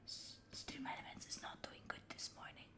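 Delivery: a soft whispered complaint; whispered aside. Real, not acted. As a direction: whisper it as a secret to chat. Quiet aside about broken alerts.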